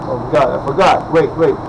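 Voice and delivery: ordinary delivery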